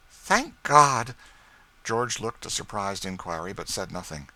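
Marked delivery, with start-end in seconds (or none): 0.1-1.2 high, much louder outburst of relief; relieved cry. A narrator's reading. Relief exclamation.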